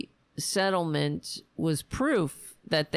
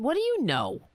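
high, sneering retort; sarcastic jab; 7 semitones higher than usual. Pretend. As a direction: sneer the question.

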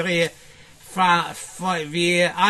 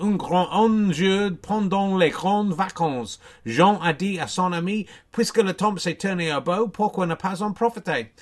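reciting French; 3 semitones higher than usual. Shown as a demonstration. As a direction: recite it like a school exam piece. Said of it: "the opening to an essay".

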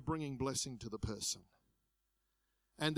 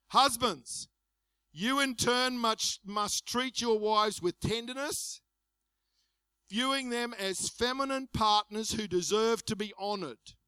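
higher, slow, declaimed reading; scripture proclamation; 4 semitones higher, 4 dB louder than usual. Acted. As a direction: proclaim it slowly to the husbands. Reads scripture to the husbands.

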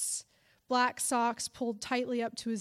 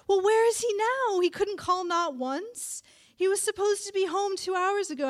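high, loud fretting; old woman panicking over missing husband; frantic worry; 9 semitones higher, 6 dB louder than usual. Acted. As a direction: sound frightened and aggrieved. Grandma panicking at midnight.

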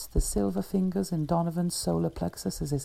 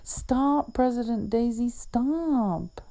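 put-on high child's urgent call; a little girl begging him to stop; girl pleading stop; 7 semitones higher, slower than usual.

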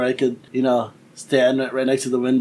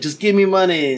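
higher, louder blunt ask; blunt demand; 6 semitones higher, 4 dB louder than usual. Retold or imitated. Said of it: "when people are like".

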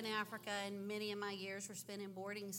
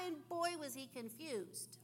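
normal talking voice

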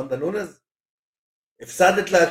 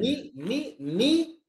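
baffled; 8 semitones higher, 6 dB quieter, much slower than usual. Real, not acted. Baffled at the Russian name.

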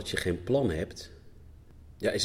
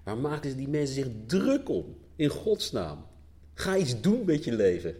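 higher-pitched mock exasperation; raised-pitch, incredulous mock complaint; exasperated outsiders; 8 semitones higher, 4 dB louder than usual. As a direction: imitate baffled outsiders dismissing the whole thing. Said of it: "mensen van buiten denken".

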